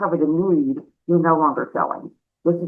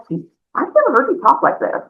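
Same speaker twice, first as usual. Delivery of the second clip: surprised; 7 semitones higher, faster than usual. Retold or imitated. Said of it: "I'm like".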